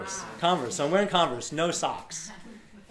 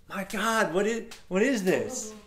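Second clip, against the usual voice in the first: higher, marveling retold exclamation; acted amazement; 6 semitones higher, slower than usual. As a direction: marvel at an unexpected gift. Acts amazement at the gifted hat.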